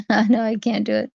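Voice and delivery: ordinary talk, nothing marked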